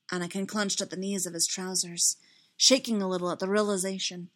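plain reading voice

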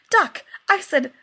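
put-on flustered rushed correction near the baby; hasty cover-up. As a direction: cover the curse fast and loud. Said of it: "said hastily".

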